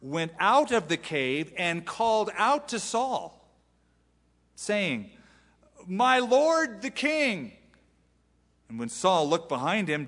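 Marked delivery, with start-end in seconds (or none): 0.0-5.1 slow, raised preaching read; dramatic scripture reading. Acted. Preacher reads scripture with force. 5.9-7.5 high, slow, reverent call; calling out to the king. Acted. David calls out to Saul.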